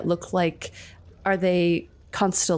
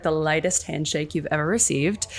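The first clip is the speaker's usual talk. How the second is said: usual speaking voice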